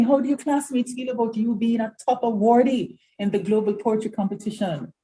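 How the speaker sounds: usual speaking voice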